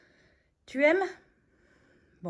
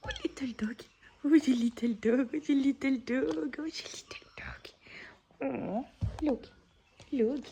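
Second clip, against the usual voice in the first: cooing to a dog; 3 semitones higher, 7 dB quieter than usual. Real, not acted.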